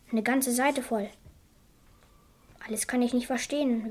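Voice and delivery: ordinary talk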